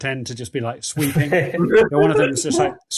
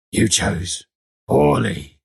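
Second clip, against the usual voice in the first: voz de Dutch; 2 semitones lower, 4 dB louder, slower than usual. Acted. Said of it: "as Dutch is, is like".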